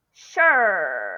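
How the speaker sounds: "Sure" loud, drawn out and falling; helping word. Shown as a demonstration. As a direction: stretch "Sure" to find the curled-tongue sh sound. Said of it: "So try to find it".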